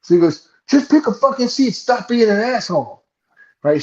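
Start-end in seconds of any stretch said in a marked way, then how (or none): 0.7-3.0 mock screaming. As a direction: imitate a fed-up passenger screaming at someone. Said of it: "Screaming at me".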